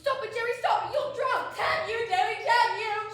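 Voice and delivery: loud, high, desperate cry to stop him; frantic pleading shout. Acted. Hostess shrieking at drunk Jerry to stop. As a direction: yell at him to stop, near panic.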